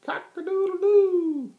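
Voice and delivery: very high, loud, slow crowing; drawn-out high crow; rooster crow. A narrator's reading. Rooster crows.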